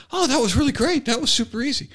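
squeaky high, hammed-up husband; high mock husband. Mocking husband in video, very high and hammed-up. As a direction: pitch way up, play it silly. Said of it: "He goes".

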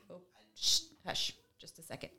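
usual speaking voice

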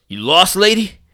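loud gruff street tough; tough thug voice. Retold or imitated. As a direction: play a menacing stranger. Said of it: "he's dressed like a thug".

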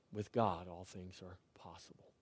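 soft whispered promise. Real, not acted. Preacher whispering the refrain to his congregation. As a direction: breathe the refrain in a hush.

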